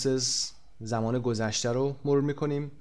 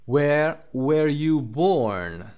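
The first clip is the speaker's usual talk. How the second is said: drawn-out model question, louder; teacher stretches each word of the question out loud; slow loud model question; 3 semitones higher, 6 dB louder, much slower than usual. Shown as a demonstration. Teacher drilling Persian students on the question, slow and loud. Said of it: "گفتیم که ساختار تولد به این شکل هست".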